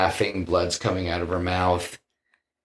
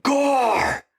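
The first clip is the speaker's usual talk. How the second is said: calling out. Retold or imitated. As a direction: shout the name across. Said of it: "and he's like".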